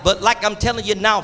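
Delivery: normal talking voice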